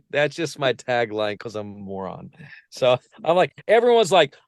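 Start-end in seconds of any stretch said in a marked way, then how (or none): none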